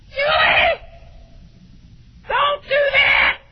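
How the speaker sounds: high, loud yell, slow on the last words; imitating his own shout. Father yelling at daughter Julie not to do it, high loud shout. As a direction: snap at her like the moment it happened. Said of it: "exactly the way I said it".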